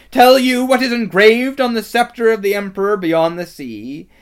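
high, loud, ringing tirade; imperious villainess at full volume; shrill witch. A narrator's reading. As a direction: declaim in shrill outrage, hammering each clause. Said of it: "her voice growing suddenly shriller".

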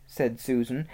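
usual speaking voice

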